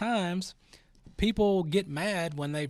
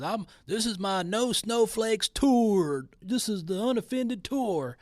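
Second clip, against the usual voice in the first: higher, louder mock tough-guy boast; 5 semitones higher, 4 dB louder, slower than usual.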